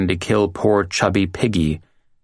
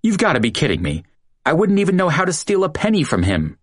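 panicked; 9 semitones higher, faster than usual. A narrator's reading.

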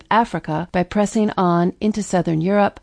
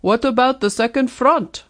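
put-on heavy Russian accent; 5 semitones higher than usual. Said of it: "with a heavy Russian accent".

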